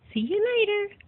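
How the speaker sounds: high cooing see you later; high pet-talk farewell. Real, not acted. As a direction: coo the goodbye way up high.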